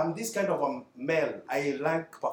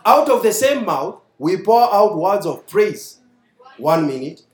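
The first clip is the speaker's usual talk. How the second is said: loud, emphatic preaching read; scripture reading; 3 semitones higher, 6 dB louder than usual. Acted. Preacher reads James to the congregation, forceful. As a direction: preach the verses at full force.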